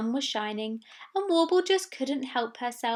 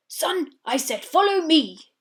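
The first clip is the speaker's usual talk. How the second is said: stern, louder order; firm father; 5 semitones higher, 6 dB louder than usual. Acted. Big walrus orders his son firmly. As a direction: put your foot down.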